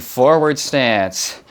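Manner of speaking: ordinary talk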